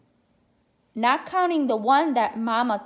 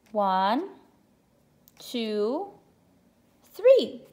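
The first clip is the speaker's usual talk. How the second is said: excited counting; much slower than usual. Shown as a demonstration. Counting aloud with children.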